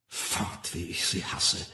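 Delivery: very soft, low, half-whispered; inner thought. A narrator's reading. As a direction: think the hatred under your breath. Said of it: "dachte Bernard Marx". (leise, in Gedanken)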